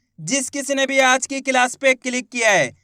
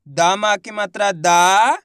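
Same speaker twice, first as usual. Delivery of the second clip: slow, louder sounding-out of दा; syllable by syllable; 3 semitones lower, 5 dB louder, slower than usual. Shown as a demonstration. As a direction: spell it out slowly for the class. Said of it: "मैं किस तरीके से एक एक अक्षर का उच्चारण करके". (धीरे और ज़ोर से अक्षर बोलना)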